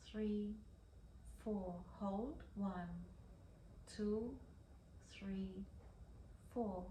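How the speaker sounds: soft, unhurried guided breathing voice; slow breath counting. Acted. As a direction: count the breaths slowly and gently. Leads a breathing exercise.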